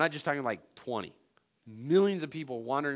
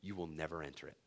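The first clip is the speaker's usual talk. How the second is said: much softer, low solemn verdict; God's verdict; 8 semitones lower, 14 dB quieter, faster than usual. Retold or imitated. God telling Moses on the mountain, quiet solemn verdict. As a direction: deliver the ban quietly and gravely. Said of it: "He goes".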